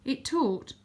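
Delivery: usual speaking voice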